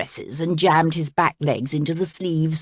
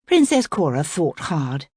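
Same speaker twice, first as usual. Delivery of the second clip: ordinary delivery, nothing marked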